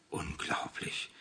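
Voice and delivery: slow awed whisper; impressed whisper. A narrator's reading. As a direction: breathe it out, amazed. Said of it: "bestätigte Anna beeindruckt".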